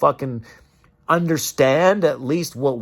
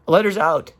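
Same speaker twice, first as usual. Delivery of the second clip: usual speaking voice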